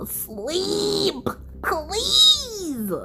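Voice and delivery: high, drawn-out scoff; mock it with disbelief. Scoffing correction.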